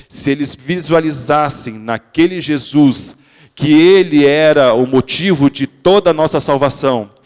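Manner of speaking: impassioned sermon climax; fervent preaching. Acted. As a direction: drive the point home to the congregation. Preacher builds to full force.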